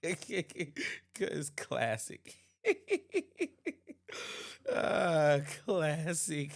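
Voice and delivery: words squeezed out through laughter; can barely talk for laughing; real, helpless laughter. Real, not acted. Laughing hard at the clip.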